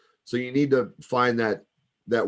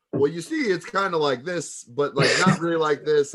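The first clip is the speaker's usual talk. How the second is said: high-pitched, louder mock justification; mock explaining; 6 semitones higher, 5 dB louder than usual. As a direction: play someone awkwardly explaining themselves. Mimics performer explaining his character.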